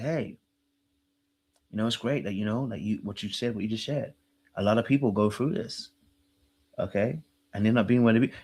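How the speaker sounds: soft, low imitation of calm comforting; calm talk. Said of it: "you see how calm he talks?".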